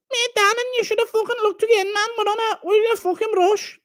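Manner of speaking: very high put-on woman's voice; nagging wife. Wife hurrying her searching husband, high nagging voice. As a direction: scold him in a high mock-wife voice. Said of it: "That's his wife".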